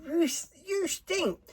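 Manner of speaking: high, slow, disgusted voice; Georgia disgusted. Retold or imitated. Georgia telling Carl he stinks, high disgusted voice. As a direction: drag it out, high and grossed out. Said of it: "she goes".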